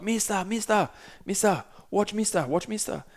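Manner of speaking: put-on high, eager child voice calling mister; imitating little kids. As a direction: pitch up like small excited kids calling out.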